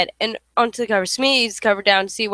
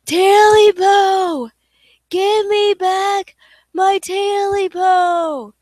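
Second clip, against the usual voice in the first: slow, much higher, louder demanding wail; slow, loud ghostly shriek; 10 semitones higher, 6 dB louder, much slower than usual. Acted. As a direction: shriek it like a spirit demanding back its own. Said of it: "Scratch grew louder and louder".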